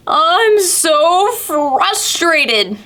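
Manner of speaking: very slow, high drawn-out whine; frustrated turtle. Acted. Turtle whining to Pete, very slow and high. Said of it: "said Turtle".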